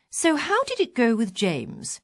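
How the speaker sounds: icy question. Retold or imitated. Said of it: "she said icily".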